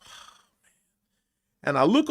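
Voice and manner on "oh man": voiceless, deflated murmur; thinking to himself. Retold or imitated. Actor to himself after failing to cry, deflated. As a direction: let it out under the breath, barely there. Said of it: "I was just thinking to myself".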